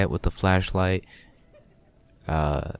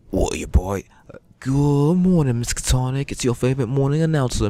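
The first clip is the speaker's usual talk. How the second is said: higher, cheery Cockney morning announcer; Cockney accent; 5 semitones higher than usual. Acted. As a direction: chirp the school news in a Cockney accent. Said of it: "she had the most Cockney accent in my head".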